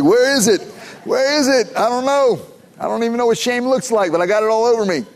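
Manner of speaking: put-on confused little kid. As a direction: act out a puzzled small boy. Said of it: "I was like".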